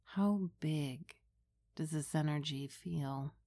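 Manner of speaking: hushed and unhurried, close to the ear; right in your ear. Acted. Leads a guided meditation.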